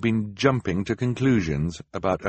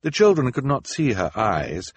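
ordinary talk

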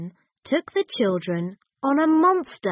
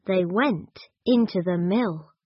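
ordinary delivery

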